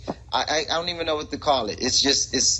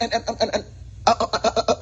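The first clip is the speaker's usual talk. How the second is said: rapid repeated stammer, higher pitch; preacher's stutter; 6 semitones higher, much faster than usual. Retold or imitated. Next time you hear that, mock preacher stutter. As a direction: imitate a pulpit stutter, syllable after syllable. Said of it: "you hear that".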